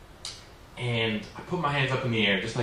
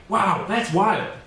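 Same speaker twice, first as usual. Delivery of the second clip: slow, higher and louder fake amazement; mock dramatic surprise; 8 semitones higher, 6 dB louder, much slower than usual. Shown as a demonstration. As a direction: stretch it out like you're shocked by gossip. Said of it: "something a little bit more dramatic".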